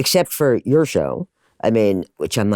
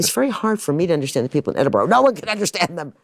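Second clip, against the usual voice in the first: high-pitched, quick mock protest; retold baffled complaint; exasperated complaint; 8 semitones higher, faster than usual. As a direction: climb to an exasperated high finish. Retells complaining in London about Edinburgh.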